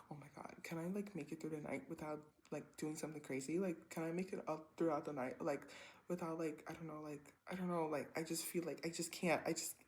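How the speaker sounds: soft, worried inner monologue; anxious self-talk. Retold or imitated. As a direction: fret quietly to yourself.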